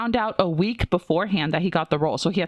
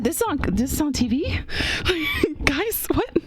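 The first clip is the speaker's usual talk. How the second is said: shocked disbelief; 9 semitones higher than usual. Retold or imitated. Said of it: "I was like".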